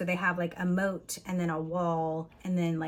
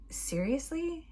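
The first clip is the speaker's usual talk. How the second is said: incredulous; 9 semitones higher, slower than usual. Retold or imitated. Heroine reacts to the name Gaston.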